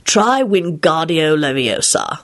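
Lupin coaching his son; calm instruction. A narrator's reading.

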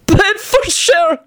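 very high, drawn-out mirthful disbelief; gasped out. Acted. Benji echoes Antonin's answer, gasping high with hilarity. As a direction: squeal it slowly, overcome with mirth. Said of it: "Benji gasped out".

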